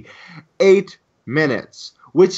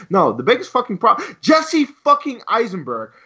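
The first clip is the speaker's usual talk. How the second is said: usual speaking voice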